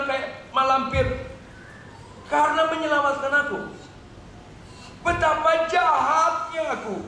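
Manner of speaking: high, mounting remorse; anguished self-reproach. Acted. Daughter realises mother was scarred saving her, anguished remorse. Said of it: "mulai dia mikir".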